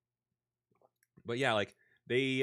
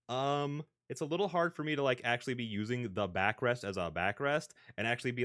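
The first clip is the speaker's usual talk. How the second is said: usual speaking voice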